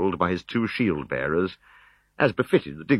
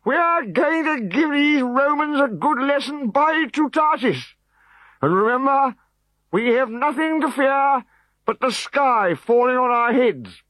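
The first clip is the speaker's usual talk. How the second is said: slow, high and loud oration; high slow booming speech; rousing speech; 4 dB louder, slower than usual. A narrator's reading. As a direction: stir the warriors grandly.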